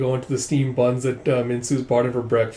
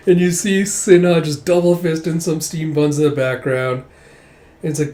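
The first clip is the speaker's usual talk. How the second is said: ordinary delivery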